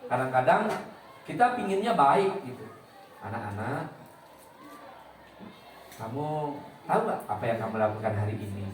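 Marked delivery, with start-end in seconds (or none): 3.2-8.7 soft, low voice with a biting edge; sarcastic jab. Shown as a demonstration. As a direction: needle the class quietly. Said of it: "nyindirnya itu nyindir habis".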